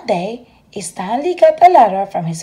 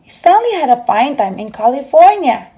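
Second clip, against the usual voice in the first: plain reading voice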